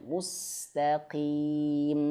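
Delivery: mustaqim very slowly with a light qaf; the correct version; plain light qaf. Shown as a demonstration. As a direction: say mustaqim with an ordinary, unforced qaf. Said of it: "tapi biasa aja".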